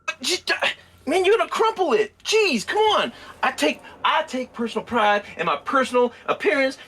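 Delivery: put-on high, cranky old man voice; old codger voice. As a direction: whine like a fussy old codger.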